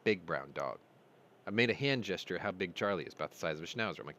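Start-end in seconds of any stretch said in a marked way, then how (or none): 0.0-0.8 lower, flat correction "Big brown dog."; UPS guy correcting. Retold or imitated. UPS driver correcting the dog owner, lower and matter-of-fact. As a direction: set him straight, voice dropped. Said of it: "And he goes".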